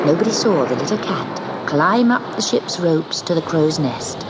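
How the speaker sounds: usual speaking voice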